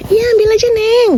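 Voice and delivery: high voiced neighbor lady. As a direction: play the kind neighbor, high.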